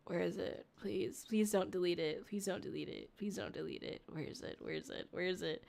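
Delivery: very soft, low pleading to oneself; hushed, repetitive begging while searching; anxious muttering. Real, not acted. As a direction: mutter it under your breath, fretting. Anxiously searching for a saved video.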